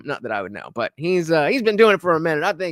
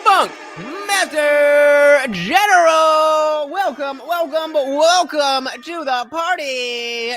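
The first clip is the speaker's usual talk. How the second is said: long drawn-out shouted welcome, much higher; hype shout; 10 semitones higher, 6 dB louder, slower than usual. Real, not acted. Streamer hyping up a donor to his chat, shouting slowly. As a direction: bellow the name like a ring announcer.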